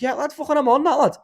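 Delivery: high, loud dazed agreement; half-asleep self. Retold or imitated. Waking mid-conversation, imitating his dazed high blurt. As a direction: blurt it like you've just woken mid-chat. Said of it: "be like".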